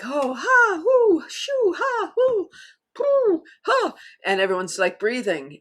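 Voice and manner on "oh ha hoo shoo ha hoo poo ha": very high, loud whooping ha-hoo laughs; hooting belly laughs that run away with you; uncontrolled laughing fit. Shown as a demonstration. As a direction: lose it completely, squealing with laughter. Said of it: "it's like".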